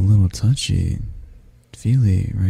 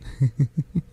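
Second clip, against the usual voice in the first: high, soft little giggle; quiet affectionate chuckle; 5 semitones higher, 5 dB quieter than usual. Acted. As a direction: giggle while squeezing them. Squeezing guest tight.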